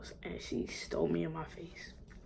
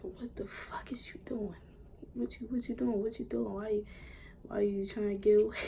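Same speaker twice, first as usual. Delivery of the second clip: angry mom; 5 semitones higher than usual. Retold or imitated. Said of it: "She's like".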